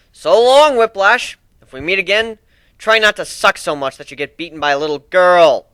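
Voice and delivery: loud, high mocking goodbye; taunting farewell. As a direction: jeer at him as you fly off. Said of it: "I taunted".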